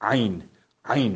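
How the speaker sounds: عین with a throaty Arabic ain. Shown as a demonstration. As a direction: pronounce عین the way Arabs do.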